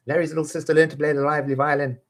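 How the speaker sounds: rushed high race through the line; very fast. Shown as a demonstration. Teacher rattling off the twister. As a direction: blurt it out at top speed. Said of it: "fast take".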